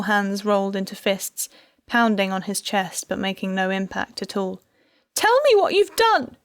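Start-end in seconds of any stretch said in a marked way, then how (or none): 5.1-6.4 high, loud desperate scream. A narrator's reading. Wife screaming at her husband, desperate. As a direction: scream the demand at him. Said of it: "she screamed".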